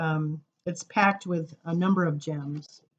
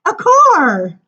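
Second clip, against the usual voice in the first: eager answer; 12 semitones higher, 13 dB louder than usual. Acted. Child in poem answers eagerly.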